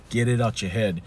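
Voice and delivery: usual speaking voice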